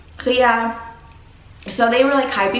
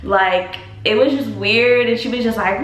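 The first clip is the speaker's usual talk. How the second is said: ordinary talk, nothing marked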